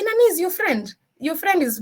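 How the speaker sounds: high-pitched mock complaint; imitated colleague's complaint. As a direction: imitate a colleague raising it with Joanne. Imitating complaining colleagues about friend.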